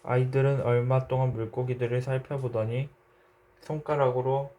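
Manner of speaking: plain reading voice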